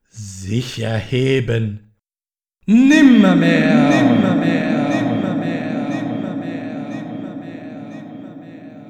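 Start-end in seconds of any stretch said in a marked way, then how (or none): 2.7-8.8 starts loud, dies away to nothing; fading echo. Acted. The raven's word echoes endlessly, loud fading to silent.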